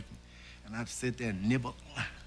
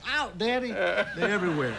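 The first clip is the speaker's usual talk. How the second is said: voicing his kid; 6 semitones higher than usual. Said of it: "You sit down".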